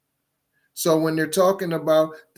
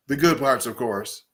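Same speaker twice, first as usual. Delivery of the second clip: sarcastic mutter; 3 semitones lower than usual. Real, not acted. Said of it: "the good, the good parts".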